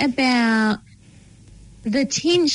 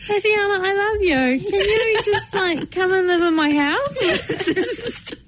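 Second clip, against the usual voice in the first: moved, near tears; 6 semitones higher than usual. Real, not acted. Host moved by the guest's story, teary. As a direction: voice wobbling with emotion, laughing through it.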